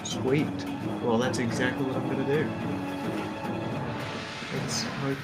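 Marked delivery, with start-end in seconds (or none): none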